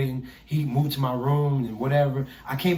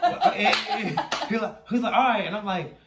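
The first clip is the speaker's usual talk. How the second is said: retold outraged complaint; 8 semitones higher than usual. Telling mentor about stolen shoes.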